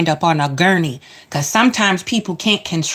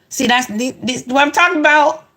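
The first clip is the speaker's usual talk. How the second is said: Chicago coming out; 6 semitones higher than usual. Real, not acted. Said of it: "I woke up Chicago over here".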